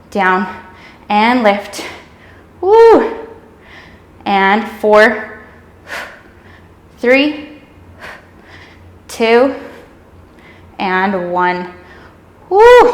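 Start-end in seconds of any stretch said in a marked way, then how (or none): 2.6-3.3 excited whoop. Real, not acted. Instructor cheering mid-exercise. 12.5-12.9 high, loud burst of a whoop; excited whoop. Real, not acted. Instructor cheering mid-exercise.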